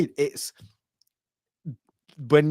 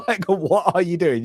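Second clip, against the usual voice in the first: laughing; 7 semitones higher, 5 dB louder than usual. Real, not acted. Laughing at the duelist.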